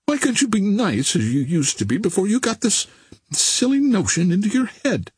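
high-pitched peevish pleading; petulant. A narrator's reading. Barbara begs Billy to drop his notion, petulantly. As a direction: complain like a piqued girl. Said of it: "cried the girl petulantly".

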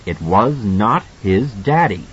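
usual speaking voice

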